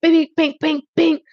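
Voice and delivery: high, loud cartoon hits; mock punch sounds. Laughing punch sound effects.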